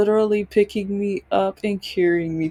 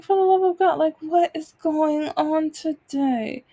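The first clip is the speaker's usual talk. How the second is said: exasperated outburst; 7 semitones higher than usual. Retold or imitated. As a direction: throw your hands up, for the love of God. Exasperated at offer of help.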